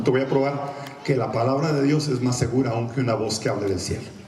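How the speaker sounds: ordinary talk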